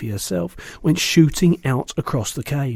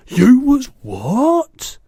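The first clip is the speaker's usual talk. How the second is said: high, slow, louder shocked cry; cried out; 12 semitones higher, 7 dB louder, much slower than usual. A narrator's reading. BFG shocked to hear Sophie was eaten, crying out. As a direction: gasp it in alarm. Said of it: "cried the B F G".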